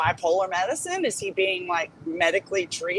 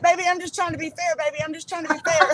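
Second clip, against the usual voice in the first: high, fast sweet mock voice; imitating a placating friend; mocking the host; 8 semitones higher, faster than usual. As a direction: coo it sweetly to calm her down. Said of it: "Queen's like".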